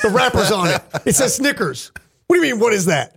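playful exasperated comeback; mock exasperation. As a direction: point out the obvious in disbelief. Acts out exasperated reply to coworker.

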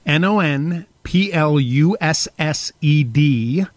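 slow letter-by-letter spelling; spelled out. Shown as a demonstration. Host spelling the word for listeners, slow spelling. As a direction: spell each letter deliberately. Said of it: "spelling the word".

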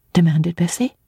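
ordinary delivery

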